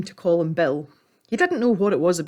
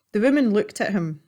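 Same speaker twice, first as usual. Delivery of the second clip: usual speaking voice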